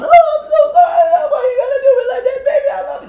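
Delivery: hammed-up tearful yelling reunion; crying shout. Wife rushes to husband at the door, mock wailing. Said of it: "and they'd come out".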